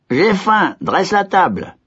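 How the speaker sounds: voix haute de l'idiot; idiot qui ordonne. A narrator's reading. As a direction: imiter le vieillard naïvement. (l'idiot imite l'ordre du vieillard, voix haute)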